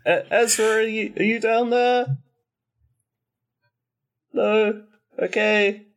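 calling out. Acted. Calling down stairs for Ezra.

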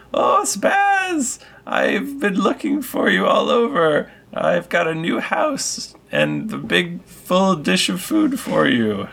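high, sweet cat-lady voice; Alice voiced. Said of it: "she's all".

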